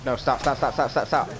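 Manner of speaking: urgent repeated stop; panicking mid-fight; frantic yelling. Real, not acted.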